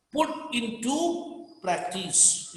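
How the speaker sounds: "Put into practice" spaced out slowly; slow dictation. Shown as a demonstration. Teacher repeats the meaning for students, slow dictation.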